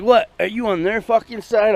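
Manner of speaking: put-on high, belligerent drunk shout; drunk angry challenge. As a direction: play the drunk picking a fight. Drunk coworker squaring up.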